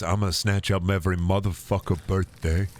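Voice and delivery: Steven Seagal impression. Streamer quoting a bad movie line as a lightly voiced low mumble. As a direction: mumble it low like a washed-up action hero. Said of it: "mumbling like Steven Seagal in A Good Man when he says".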